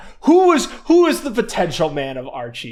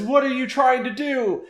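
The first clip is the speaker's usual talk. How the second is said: higher, alarmed villain voice; Robotnik panicking; 8 semitones higher than usual. Retold or imitated. Robotnik panicking as he runs away, in a high alarmed voice. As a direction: squawk it in panic. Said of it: "as he says".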